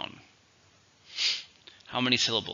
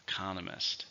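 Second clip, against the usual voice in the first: "Economist" with the stress on CON; stress on second syllable; 3 semitones lower, 11 dB quieter than usual. Shown as a demonstration. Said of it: "stress is on the second syllable".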